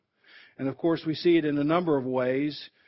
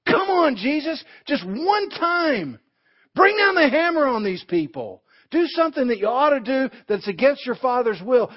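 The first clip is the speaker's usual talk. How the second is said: much higher, louder coaxing taunt; Satan's tempting voice; 9 semitones higher, 6 dB louder than usual. Retold or imitated. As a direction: tempt him like the devil, pushing hard. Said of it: "That's Satan".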